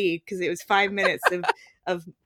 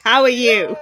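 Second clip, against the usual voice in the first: louder, tender-voiced hello; sweet greeting to a pig; 3 semitones higher, 10 dB louder than usual. [name] crouching face to face with a pig, loud and sweet.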